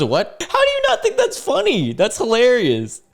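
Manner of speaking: very high-pitched acted disbelief; mock incredulity. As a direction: squeal it in exaggerated astonishment. Acts out the man's incredulous reply.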